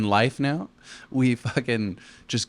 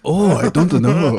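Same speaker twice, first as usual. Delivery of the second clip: slow, loud, higher mock Mexican accent; exaggerated Mexican accent; 4 semitones higher, 8 dB louder, slower than usual. Shown as a demonstration. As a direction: belt out a hammed-up Mexican accent. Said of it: "Can you do the accent a little bit more?".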